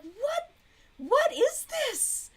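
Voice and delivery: very high-pitched, drawn-out disbelief; exaggerated, slow, high incredulity; incredulous. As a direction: squeak it out in bewilderment. Retelling disbelief at the heroine.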